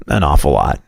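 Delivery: ordinary talk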